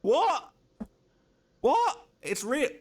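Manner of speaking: high squeaks of disbelief; very high stunned yelps; shocked cries. Real, not acted. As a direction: squeal each word in shock. Shocked at the photo.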